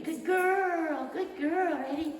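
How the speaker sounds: lavish praise on a good dog; gushing dog praise. Real, not acted. Trainer praising Kip for grabbing the toy, high excited gush.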